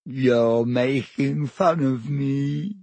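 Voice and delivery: slow, wailing accusation; wounded, drawn-out moan; tearful. A narrator's reading. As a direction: whine slowly as tears well up. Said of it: "silver tears welling rapidly".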